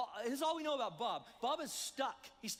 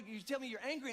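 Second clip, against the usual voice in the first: ordinary delivery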